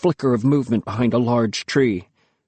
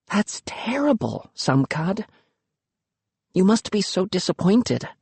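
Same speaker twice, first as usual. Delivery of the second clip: sympathetic hush; 6 semitones higher than usual. Acted. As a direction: comfort him gently. Luki sympathizes softly.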